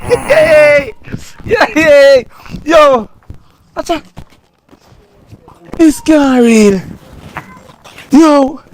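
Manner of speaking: loud threatening robber; menacing shout. Acted. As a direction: bark at the victim to hand it over.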